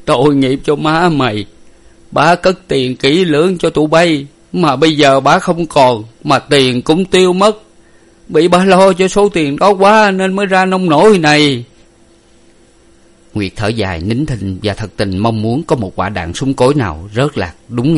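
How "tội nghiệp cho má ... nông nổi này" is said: high, loud keening; weeping lament. A narrator's reading. Father mourns over his wife's body, weeping lament. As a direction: wail out the grief, building to the end. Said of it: "khóc kể luôn".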